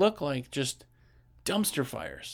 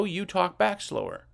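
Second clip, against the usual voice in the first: slow down on "you talk back slower"; "you talk back slower" drawn out, unhurried; 3 semitones higher than usual. Shown as a demonstration. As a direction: stretch out "slower" to show a slow talker. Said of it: "talking to you slow".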